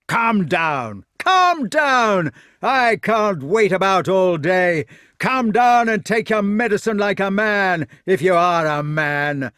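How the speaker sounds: loud, high, impatient orders; angry shouting. A narrator's reading. Fat knight to the man up the tree, shouting angrily.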